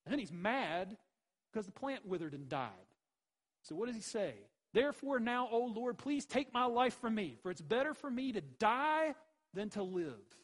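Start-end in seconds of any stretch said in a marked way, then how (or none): 4.7-10.4 loud, high, anguished plea to die; desperate outcry. Acted. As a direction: cry out that death is better. Jonah begs God for death.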